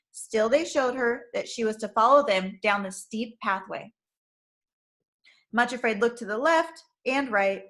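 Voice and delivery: usual speaking voice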